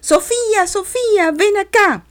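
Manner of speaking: put-on high, loud call after a running child; teacher shouting a name. A narrator's reading. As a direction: call her name across the playground. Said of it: "la maestra me llamaba".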